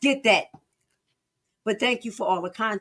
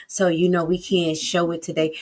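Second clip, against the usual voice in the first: ordinary talk, nothing marked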